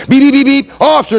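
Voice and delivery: high, urgent woman calling out; high-pitched honking lady. Retold or imitated. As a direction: squeak it out like an impatient lady driver.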